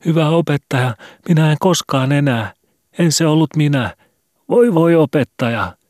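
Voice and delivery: high, loud wailing pleas; schoolboys' desperate high-pitched begging; heart-rending cries. A narrator's reading. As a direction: beg the teacher at the top of your voice. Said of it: "sydäntä särkeviä huutoja".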